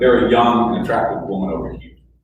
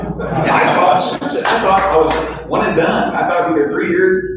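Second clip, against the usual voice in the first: laughing; 7 semitones higher, 4 dB louder than usual. Real, not acted. Laughing at himself.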